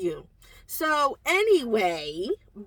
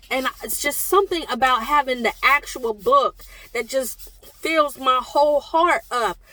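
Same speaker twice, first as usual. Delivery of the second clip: normal talking voice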